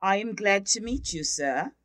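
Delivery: usual speaking voice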